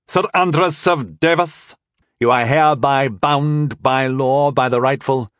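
slow, officious decree; a mock official arrest; formal proclamation. A narrator's reading. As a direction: read the charge like a herald.

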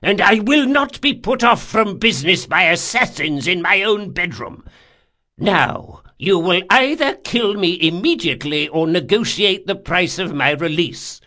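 put-on high, loud, furious old man's voice; angry old man. A narrator's reading. Old man defies assassins in his bedroom, angry. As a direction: lay down an ultimatum in anger. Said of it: "Anger changed his countenance utterly".

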